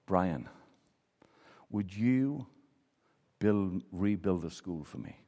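soft, low, halting plea with long pauses; subdued grave plea. Retold or imitated. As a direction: imitate a stricken mayor, quietly pleading. Mayor's grave plea after disaster.

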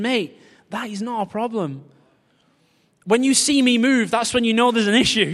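hammed-up chill reassurance; God's casual reply. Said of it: "he's thinking".

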